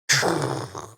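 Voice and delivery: mouth-made rifle report; vocal shot sound effect; imitated gunshot. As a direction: make a rushing gunshot noise with your mouth.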